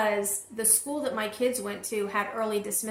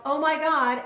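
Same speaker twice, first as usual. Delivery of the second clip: louder, higher mock yell; retold yell; 5 semitones higher, 5 dB louder than usual. Mother hearing the school doesn't know, retold yell. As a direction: blurt it out as an imitated yell. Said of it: "And I yelled".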